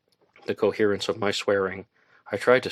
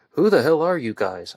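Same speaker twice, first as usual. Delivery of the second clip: loud, high, alarmed demand; startled shout at the strangers dragging him out; 7 semitones higher, 6 dB louder than usual. Acted. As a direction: yell it at the captors in panic. Captive shouting at captors.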